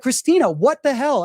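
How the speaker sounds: a high, loud half-joking reproach; retold yell at Christina. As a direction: call her out, half joking. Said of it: "I kinda yelled at her a bit".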